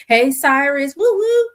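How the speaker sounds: loud, high greeting rising into a whoop; playful call-out. Real, not acted.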